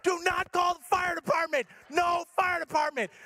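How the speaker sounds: high, yelled insistence; mock frantic command. Husband forbidding wife to phone for help, high frantic mock yell.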